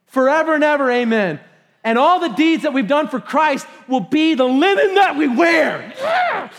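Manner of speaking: loud, rising to a high whoop; preacher whooping with excitement; excited. Real, not acted. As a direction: build to a joyful shout. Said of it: "that just gets me excited".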